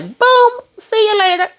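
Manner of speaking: high put-on voice for a breezy goodbye; mock cool exit line. Diamond walking away from Tommy, high breezy mock farewell. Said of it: "And then turned around and was like".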